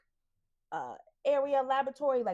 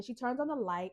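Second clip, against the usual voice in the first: usual speaking voice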